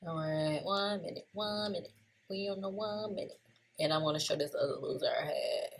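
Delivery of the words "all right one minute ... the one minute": very soft, slightly raised pitch, to oneself; quiet self-talk. Real, not acted. Trader finding the one-minute chart, quiet self-talk. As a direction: murmur it absently while searching.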